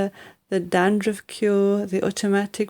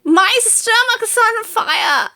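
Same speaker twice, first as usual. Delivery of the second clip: panicked wail; 14 semitones higher, 4 dB louder, slower than usual. Acted. Grandma panicking.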